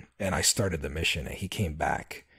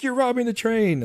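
mock child voice; 5 dB louder than usual. Son accusing her during game.

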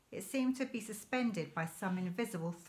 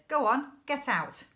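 higher, louder barked order; angry shout; 7 semitones higher, 9 dB louder than usual. A narrator's reading. Keeper ordering father and son away, angry shout. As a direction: drive them off with a shout.

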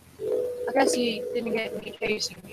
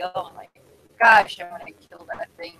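ordinary talk

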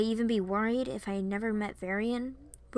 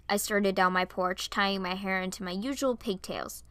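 ordinary delivery

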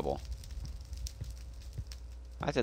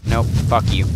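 loud frustrated yell at the mob; angry shout; 4 semitones higher, 12 dB louder than usual. Real, not acted. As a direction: snap it out, furious and loud. Mob dodging his attack.

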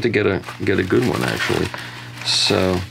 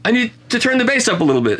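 lightly put-on higher, quicker quote; imitating Stan; 12 semitones higher, faster than usual. Retelling how an engineer mastered records, lightly imitating him, higher-pitched. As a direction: mimic a bass-loving engineer, higher and brisk. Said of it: "he'd say".

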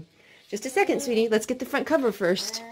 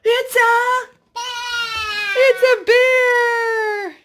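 building higher and louder to the bear; hammed-up fright, much higher and louder; rising excitement; 13 semitones higher, 5 dB louder, slower than usual. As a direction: build the alarm to a squeal. Story climax finding the bear.

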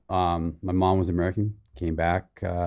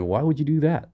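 mildly incredulous question, pitched higher; retold disbelief; 7 semitones higher than usual. Young man questioning his brother's enlistment, disbelieving. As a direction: recount it as a higher, baffled protest. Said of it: "I'm like".

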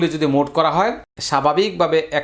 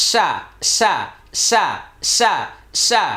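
counting beats; 3 semitones higher, much slower than usual. Shown as a demonstration. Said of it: "আটটি হরকতের উচ্চারণ করতে হবে".

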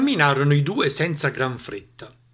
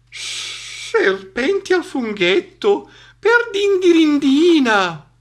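slow, high horror building louder; alarmed snake; 13 semitones higher, 6 dB louder, slower than usual. Acted. Snake hears it may be cooked, alarmed. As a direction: stretch the shock into a loud exclamation.

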